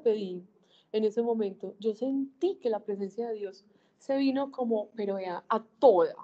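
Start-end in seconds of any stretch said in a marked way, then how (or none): 5.5-6.1 emphatic burst. Real, not acted. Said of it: "pero a toda velocidad".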